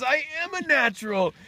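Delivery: high, loud, amazed self-praise; acted elation. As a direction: crow over a first success. Hen-man exults after laying.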